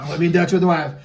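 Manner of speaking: loud mock echo of a quip. Host echoing the guest's wife quip, mock echo. Repeats the guest's line, louder.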